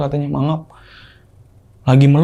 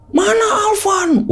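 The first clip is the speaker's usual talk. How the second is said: very high, drawn-out granny impression; loud granny voice; much slower than usual. Possessed girlfriend demanding Alvan, in a high granny voice. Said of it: "kayak nenek-nenek tapi keras gitu".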